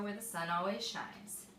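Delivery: ordinary delivery, nothing marked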